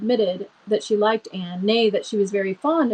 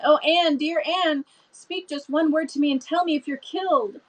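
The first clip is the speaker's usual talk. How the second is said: higher pitched, frightened begging; anxious pleading; 6 semitones higher than usual. A narrator's reading. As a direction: plead with her friend to answer. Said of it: "shrieked Diana".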